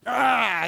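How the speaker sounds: short loud yell; angry yell demo. Shown as a demonstration. Preacher shows his congregation what real yelling sounds like, a short loud yell. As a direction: bark one angry shout. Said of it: "This is not the real yelling kind".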